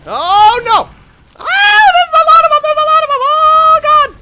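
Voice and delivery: very high, loud shrieks; frantic high-pitched gamer panic; panicked screaming. Real, not acted. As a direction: scream as if being swarmed. Swarmed by enemies.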